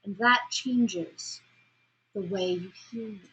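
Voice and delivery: very slow and deliberate. Shown as a demonstration. Teacher demonstrating slowed pacing to class. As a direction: stretch every word out. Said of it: "So then I slow down".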